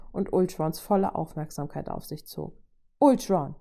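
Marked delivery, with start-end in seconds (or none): 3.0-3.6 louder, firm call. A narrator's reading. Woman demanding the robot's attention, firm call. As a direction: call the name to seize attention.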